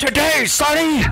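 put-on impatient old man shout. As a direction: yell like a cranky old man.

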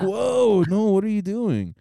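high, loud, startled objection; retold protest. Friends push back at the yelling girl, startled protest. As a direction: imitate a startled "hold on".

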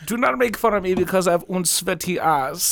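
high put-on German accent. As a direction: play it as a silly German. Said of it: "Whenever I do a German".

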